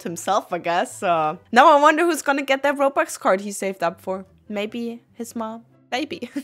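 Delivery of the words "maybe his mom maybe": quiet afterthought. Real, not acted. Wry afterthought about the card.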